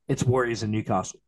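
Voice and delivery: ordinary talk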